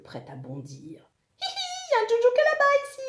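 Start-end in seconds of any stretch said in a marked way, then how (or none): 1.4-3.0 piercing high squealed alarm; loud, squeaky warning scream; yelling. A narrator's reading. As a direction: shriek it very fast at the top of your range. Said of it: "hurle Zekeye".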